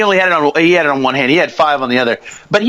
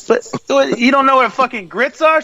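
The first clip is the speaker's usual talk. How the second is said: higher, disbelieving retort; incredulous; 7 semitones higher than usual. Retold or imitated. Telling the diner waitress off, in higher disbelief. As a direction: act stunned she has no grits. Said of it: "And I said".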